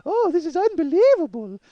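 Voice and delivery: high, meek mock Russian accent; Russian mail-order bride. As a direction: dial it down into a helpless Russian bride. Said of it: "dialed it down to go".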